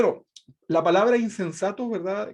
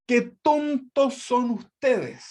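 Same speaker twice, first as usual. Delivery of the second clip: exasperated scolding; 6 semitones higher, much slower than usual. Retold or imitated. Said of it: "En la traducción al lenguaje actual dice".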